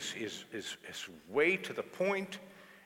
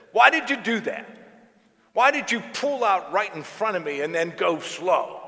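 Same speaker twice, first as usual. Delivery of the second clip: loud, higher, furious shout; angry yelling driver; 8 semitones higher, 11 dB louder than usual. Retold or imitated. Driver yells out his window at the priest, loud and furious. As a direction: bark it out the car window. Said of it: "beeping his horn and yelling".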